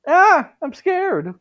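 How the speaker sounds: high, slow, loud scared squeal; a timid boy squealing in terror; frightened squeal. Acted. Gasper clutching Konako's legs, squealing in fright. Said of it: "Gasper hugged Konako's legs".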